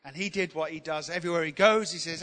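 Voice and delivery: ordinary talk, nothing marked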